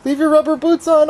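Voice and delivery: high playful scolding. Real, not acted. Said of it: "we're gonna put your boots on or we're gonna go in the house".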